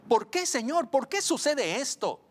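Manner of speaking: high-pitched, pleading question to God; súplica angustiada al Señor. Acted. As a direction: cry out the question, pitched well up. Acts out questioning the Lord. (pregunta suplicante, en tono alto)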